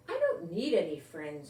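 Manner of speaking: usual speaking voice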